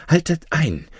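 high, ringing cry to stop the guards; a princess halting the guards mid-grab; commanding cry. Acted. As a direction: call out Haltet ein high and sharp. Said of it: "rief die Fürstin".

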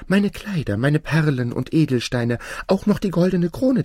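high-pitched princess, offering everything; fairy-tale princess, eagerly bidding high. Acted. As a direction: list your treasures high and pleading. Princess eagerly offers her treasures.

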